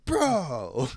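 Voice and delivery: lightly voiced party bro; frat bro. Voicing zombie frat boy.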